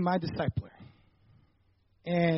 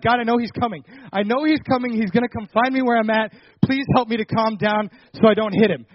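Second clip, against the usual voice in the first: angry prayer; 2 semitones higher, 8 dB louder than usual. Retold or imitated. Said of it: "trying to pray in anger".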